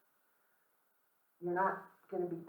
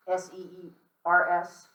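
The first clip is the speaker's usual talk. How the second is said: slow spelling S E E R S; spelled out letter by letter; 6 dB louder, slower than usual. Shown as a demonstration. Teacher to class, spelling it out slowly. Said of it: "are called seers".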